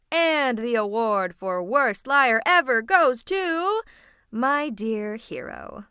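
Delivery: loud, drawn-out sarcastic proclamation; mock award announcer. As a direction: announce it slowly like a grand prize. Mock award for worst liar.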